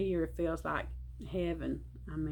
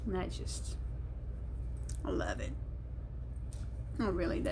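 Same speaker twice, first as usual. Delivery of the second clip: choked up; 2 semitones lower, 7 dB quieter, faster than usual. Real, not acted. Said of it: "I'm not gonna do no more crying".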